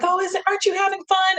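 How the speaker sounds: high, chirpy mock guide voice; sing-song cheerful question; cheery tour guide.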